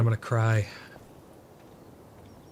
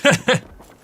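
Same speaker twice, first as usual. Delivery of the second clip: a high, loud burst of laughter; shrieking laugh; 13 semitones higher, 12 dB louder than usual. Real, not acted. Streamer after the gamble goes wrong, shrieking laugh. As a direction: cackle at your own bad luck.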